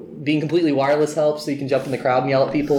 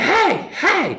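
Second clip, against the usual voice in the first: retold shout. [name] yelling from car.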